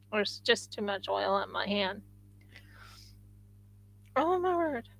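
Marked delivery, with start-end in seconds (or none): none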